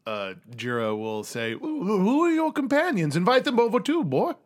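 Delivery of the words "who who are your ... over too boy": high, fussy Southern gentleman voice; hammed-up old colonel, curious. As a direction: invite them over like a genteel old father. Jiro inquires after son's companions.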